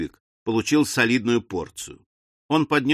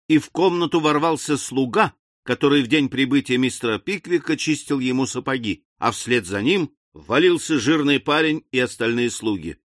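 usual speaking voice

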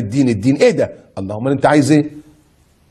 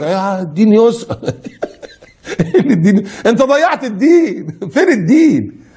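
laughing mockery; 6 semitones higher than usual. Real, not acted. Laughing at the excuse.

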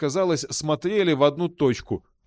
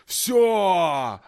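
short triumphant demon shout; 4 semitones higher than usual. Acted. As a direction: bellow the final word. Demon declares freedom.

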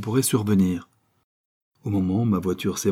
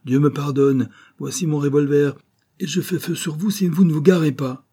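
cri furieux, plus aigu et plus fort; menace criée; 6 semitones higher, 4 dB louder than usual. A narrator's reading. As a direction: menacer de tirer en hurlant. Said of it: "cria la voix". (vieillard menace l'intrus de son revolver, furieux)

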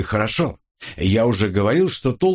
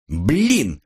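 plain reading voice

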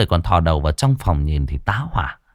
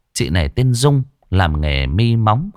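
plain reading voice

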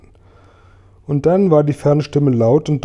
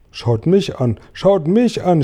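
rising to a high, eager call; mildly acted excited shout; excited cry; 6 semitones higher, slower than usual. A narrator's reading. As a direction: call for attention, more excited the second time. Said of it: "schrie Tausendfüßler aufgeregt".

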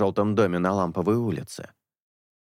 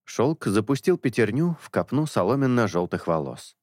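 ordinary delivery, nothing marked

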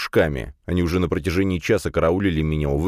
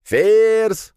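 high, loud drawn-out holler; yelling; 7 dB louder than usual. A narrator's reading. Classmate yelling for Firs at his door, loud shout. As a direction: bellow the name through a door. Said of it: "вновь заорал Вешкин".

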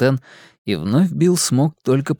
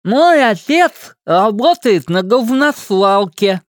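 tongue held in fingers; 9 semitones higher, 5 dB louder, slower than usual. Acted. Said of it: "схватил его пальцами и нараспев произнёс".

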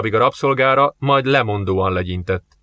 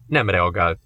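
plain reading voice

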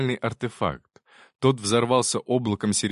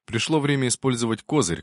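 usual speaking voice

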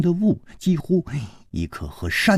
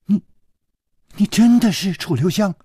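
astonished; 8 semitones higher than usual. A narrator's reading. Disbelief at his true identity.

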